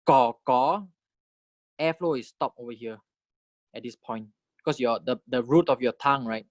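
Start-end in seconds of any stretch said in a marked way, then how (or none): 0.0-0.9 "ko ko" as two separate syllables; drawn-out "ko", lifting at the end; demonstrated syllables. Shown as a demonstration. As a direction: model each "ko" for learners, second one rising. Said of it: "When you say".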